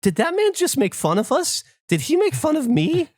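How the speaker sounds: high, anxious voicing of his wife; worried wife. Wife asking if they were mocked, high worried voice. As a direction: sound hurt and unsure. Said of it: "Emily goes".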